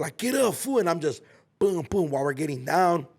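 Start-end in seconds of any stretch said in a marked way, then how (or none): 0.2-0.8 high yelled get up; mock yell. The homie yelling at him mid-fight, mock yell. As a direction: egg him on like the homie did. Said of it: "he's telling fool".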